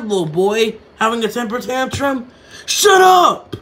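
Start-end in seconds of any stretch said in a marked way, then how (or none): 2.7-3.6 angry shout. Acted. Angry retort in press brawl.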